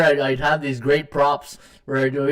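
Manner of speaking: usual speaking voice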